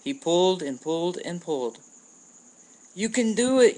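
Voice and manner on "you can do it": high, loud cheer of encouragement; little opihi cheering her friend on, loud; cheering yell. A narrator's reading. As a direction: shout it to spur him on. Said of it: "yelled Nani".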